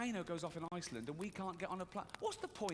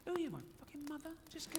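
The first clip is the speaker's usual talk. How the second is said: fast, high mock indignation; indignant retort; 9 semitones higher, 5 dB quieter, faster than usual. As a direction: snap back at the doctor like a sulky teen. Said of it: "I said".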